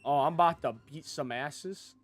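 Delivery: ordinary talk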